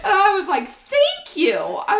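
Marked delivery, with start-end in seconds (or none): 0.9-1.6 high, loud triumphant thank you; high, loud triumphant thank you to the neighbor. Retold or imitated.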